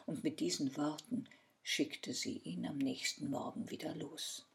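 ordinary delivery

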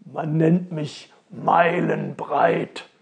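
slow, loud, stretched boast; drawn-out, hearty self-introduction; fat round fellow. Acted. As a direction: lightly play a big round man. Fat round man introduces himself.

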